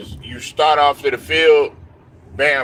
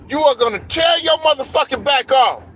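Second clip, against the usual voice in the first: high shouted retelling of the coach; coach yelling; 10 semitones higher than usual. Retold or imitated. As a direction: yell it like an angry coach on the field. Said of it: "coach was yelling at me".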